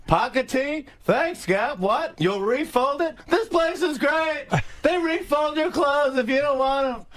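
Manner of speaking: high-pitched comic yelling; Jerry Seinfeld. Acted. Guest replays Gap bit with Seinfeld, high yelled replies. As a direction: yell back in amazed questions and exclamations. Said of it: "we're just yelling back and forth".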